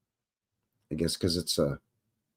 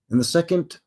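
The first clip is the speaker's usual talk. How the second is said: usual speaking voice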